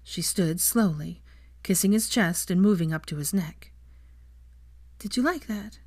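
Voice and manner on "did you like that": a hint of a higher, teasing woman's voice; lightly voiced woman. A narrator's reading. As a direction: ask it softly, a little higher, teasing him. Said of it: "she asked".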